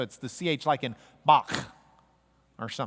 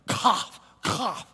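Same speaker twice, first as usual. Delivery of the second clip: whispered, coughed Cough, cough; breathy coughed Cough; 5 semitones higher, 3 dB louder than usual. Shown as a demonstration. Teacher acting out the Kaf memory trick, coughed whisper. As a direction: push Cough out of an open mouth like a real cough. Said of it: "that's the open mouth coughing".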